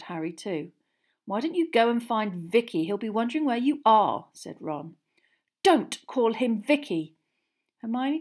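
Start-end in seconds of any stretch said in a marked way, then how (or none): 1.3-4.3 raised, loud jeer; taunting. A narrator's reading. Boy sending his friend to her date, taunting. 5.6-7.1 slow, loud angry order. A narrator's reading. Girl defending her date's name, angry. Hermione angry before storming off.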